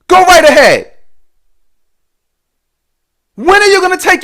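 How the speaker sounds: shouted, hammering motivational speech; loud lecturing rant. Acted. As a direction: preach it hard at the viewer.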